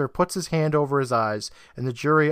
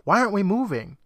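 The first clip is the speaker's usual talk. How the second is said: high-pitched mock yell; imitated urgent demand; retold yell; 7 semitones higher than usual. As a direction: call out urgently, voice raised in pitch. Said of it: "looks at Trevor yelling".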